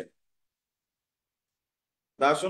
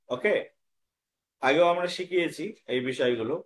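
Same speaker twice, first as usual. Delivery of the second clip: ordinary talk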